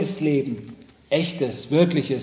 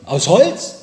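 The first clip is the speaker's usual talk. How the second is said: mildly alarmed, louder questioning rise; startled question; 3 semitones higher, 6 dB louder than usual. A narrator's reading. Father startled by his boy's birthday wish, mildly alarmed. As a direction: ask back, a touch taken aback. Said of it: "Erschrocken fragt der Vater zurück".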